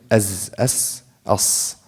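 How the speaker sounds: "Az" buzzed voiced, "as" breathy unvoiced; hams vs jahr demo. Shown as a demonstration. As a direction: contrast voiced z with hissed s. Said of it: "Zay and sin are ahruf safir".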